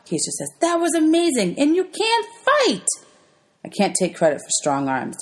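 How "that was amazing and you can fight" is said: much higher, slow, awed praise; amazed praise. Acted. Keisha marvels at how Albert fought, high and awed. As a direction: gush with wonder.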